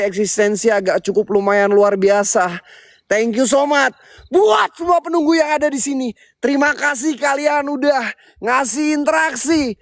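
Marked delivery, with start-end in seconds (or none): 3.0-9.8 high, far-carrying thank-you to the spirits; calling out to spirits. Real, not acted. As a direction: call it out to the whole place.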